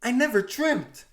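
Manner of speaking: high, loud, stunned reply; shocked. Acted. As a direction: gasp it out in disbelief. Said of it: "She replies in shock".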